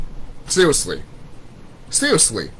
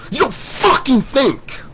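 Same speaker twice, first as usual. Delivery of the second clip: high slow contempt, a little louder; angry rant; 11 semitones higher, 4 dB louder, much slower than usual. Real, not acted. Second take to doubters, slow louder scornful voice. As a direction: stretch it out again, scornful. Repeated insult.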